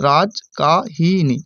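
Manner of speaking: Rajkahini said very slowly, syllable by syllable; slow syllable split. Shown as a demonstration. Teacher breaks the word into syllables for students, very slow. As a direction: stretch Rajkahini out, one syllable at a time. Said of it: "Rajkahini যদি উচ্চারণ করি".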